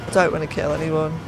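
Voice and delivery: usual speaking voice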